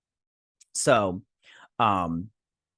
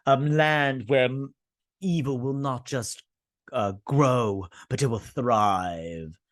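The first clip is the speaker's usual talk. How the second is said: slow, drawn-out portentous delivery; mock ominous heroine; slower than usual. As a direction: stretch each phrase like a dire prophecy. Acting out the heroine's ominous line.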